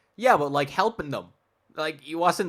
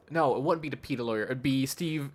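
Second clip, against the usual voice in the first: usual speaking voice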